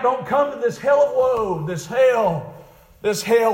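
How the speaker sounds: retold cry, hammered out in repeats; preached dying cry. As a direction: cry a warning as the dying man. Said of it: "he cried out".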